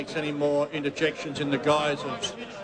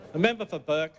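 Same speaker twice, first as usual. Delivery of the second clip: ordinary talk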